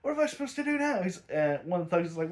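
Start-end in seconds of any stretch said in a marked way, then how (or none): 0.0-1.0 high-pitched mock frustration; retold exasperated anger. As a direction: imitate someone pissed off and at a loss. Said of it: "he is pissed beyond all reason".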